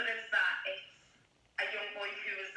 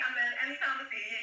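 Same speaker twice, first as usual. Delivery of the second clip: normal talking voice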